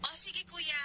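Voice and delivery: very faint telephone voice; voice on the phone. Acted. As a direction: reassure from the far end of a call. Heard from the other phone end. (napakahinang boses sa telepono)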